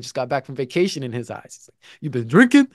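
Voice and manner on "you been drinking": loud, accusing coach impression; angry coach. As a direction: question him sharply, louder, as the coach. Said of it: "He's angry".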